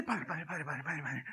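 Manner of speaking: low, hushed repeated pleading; mock inner prayer. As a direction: pray frantically under your breath, for laughs. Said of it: "porque dentro mío estoy".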